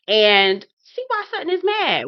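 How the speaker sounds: sudden high emphatic line; high exclamation. Real, not acted. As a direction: pitch up, exclaim.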